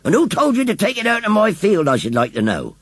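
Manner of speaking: high-pitched irate demand; roaring farmer. A narrator's reading. Farmer confronting children who moved his scarecrow, irate roar. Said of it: "roared the farmer".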